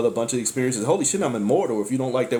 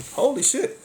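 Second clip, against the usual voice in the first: holy shit yelped much higher; mock shock; 13 semitones higher than usual. Realizing he keeps giving up power, squeaking in mock shock. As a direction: jolt up into a startled squeal.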